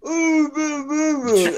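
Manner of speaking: high, laughing cartoon dog moan; Droopy Dog voice. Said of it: "is more like droopy dog".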